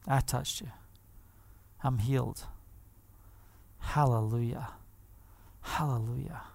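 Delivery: very soft, low, halting phrases; hushed confession. Retold or imitated. Healed woman admitting her touch to Yeshua, hushed. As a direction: murmur it low, with long pauses between.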